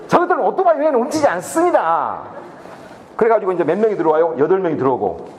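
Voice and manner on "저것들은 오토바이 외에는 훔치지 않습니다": higher, emphatic retold declaration; mock-solemn assurance. Husband assures wife about the motorbike thieves, mock-solemn declaration. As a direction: declare it firmly, playing for laughs. Teacher comically reassures his wife.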